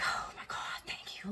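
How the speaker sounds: breathless whispered thanks; a whispered oh my God, thank you; whispered relief. Retold or imitated. Retold relief.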